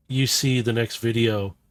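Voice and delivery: ordinary delivery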